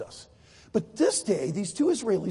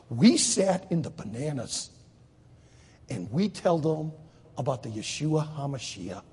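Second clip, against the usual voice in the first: ordinary delivery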